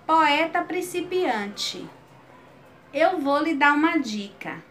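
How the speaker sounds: rhythmic cordel verse, each line lifted; poem recital. Shown as a demonstration. Teacher reciting an example stanza to the class, rhythmic recital. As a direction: recite it like a folk poet.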